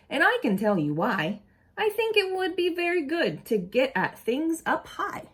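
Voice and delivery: lively storybook read-aloud voice; animated picture-book reading; reading to children. Acted. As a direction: read it to a group of kids with expression.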